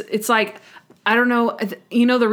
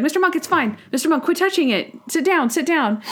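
mock scolding; 8 semitones higher than usual. Imitating Natalie scolding Monk.

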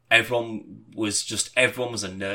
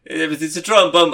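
louder, higher mock sing-song; hammy sing-song; 6 semitones higher, 9 dB louder than usual. Actor launching into the drum song, hammy. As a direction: throw out the silly catchphrase. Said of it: "And then he went".

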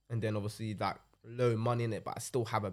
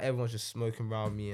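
usual speaking voice

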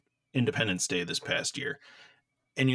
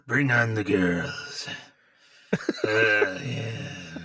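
creepy voice; 3 dB louder, much slower than usual. Pretend. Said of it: "I can hit that really creepy voice".

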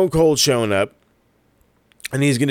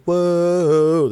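impressed whoa; 7 semitones higher, 4 dB louder than usual. Pretend. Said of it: "marks that are just been like".

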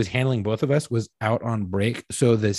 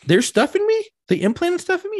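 high-pitched, incredulous questions; rising squeal of alarmed disbelief; shocked disbelief; 11 semitones higher than usual. Retold or imitated. Acting out shock at implants.

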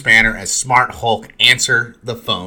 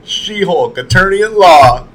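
higher, louder title-card flourish on "She-Hulk, attorney at law"; playful higher, louder announcer delivery; announcing the show title; 8 semitones higher, 7 dB louder, slower than usual. Retold or imitated. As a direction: announce it like the show's name. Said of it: "He says the name of the show".

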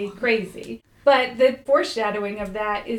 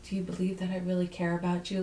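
low, soft, quick imitation of wooing; murmured, lower-pitched fake sincerity; mock romantic; 5 semitones lower, 8 dB quieter, faster than usual. Imitating boyfriend at his front door, mock romantic. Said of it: "he's trying to be, like, romantic".

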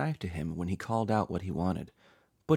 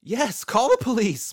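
high, loud cry of approval; elated praise; 8 dB louder than usual. A narrator's reading. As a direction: cheer the devices on with relief. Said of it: "Patrick praised them".

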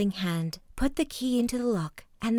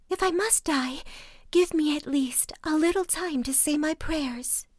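high, breathy whispered plea; trembling plea; 8 semitones higher than usual. A narrator's reading. Doomed wife begging for time to pray, high whisper. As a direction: beg for time in a high hush. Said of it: "the poor trembling creature".

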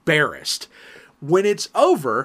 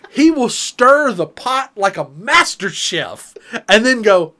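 ordinary talk

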